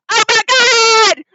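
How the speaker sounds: high, loud, drawn-out wail; acted horror. Old lady seeing a dead body, horrified wailing. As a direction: cry out in theatrical horror. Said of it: "sees a dead body. She goes".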